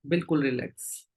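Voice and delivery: low, unhurried meditation-guide voice with long pauses; guided meditation. Real, not acted. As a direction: settle the group gently, leaving long silences. Leads group into meditation.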